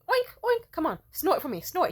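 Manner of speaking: high sing-song urging; playful coaxing. Retold or imitated. Woman coaxing lover to snort, playful high voice. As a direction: tease him into joining in. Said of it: "I'd be like".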